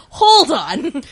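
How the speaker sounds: put-on a boyfriend stunned by the number, halting her; alarmed hold on. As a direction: cut in sharply, startled. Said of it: "And he was like".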